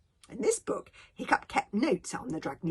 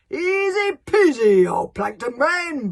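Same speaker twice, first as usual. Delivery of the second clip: big, slow, high-pitched bellow; booming; 8 semitones higher, 15 dB louder, slower than usual. Acted. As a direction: boom it out with full force. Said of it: "boomed Gobber".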